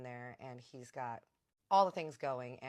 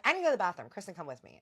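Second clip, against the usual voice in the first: urgent excuse; 7 semitones higher, 12 dB louder, much faster than usual. Acted. Pulling friend away for gossip.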